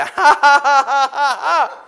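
loud, high bursts of laughter; holy laughter. Acted. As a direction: let the laughter bubble up out of you.

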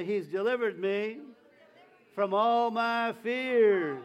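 loud, stretched congregational declaration; preacher leading a loud response; call and response. Shown as a demonstration. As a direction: lead the church in a slow, loud declaration. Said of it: "Lift that hand and say".

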